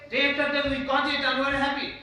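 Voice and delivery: loud, high, quick declaration; emphatic demonstration. Shown as a demonstration. Trainer demonstrates speaking with expression, loud and emphatic. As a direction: push it out forcefully in one run. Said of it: "have to have the also facial expression".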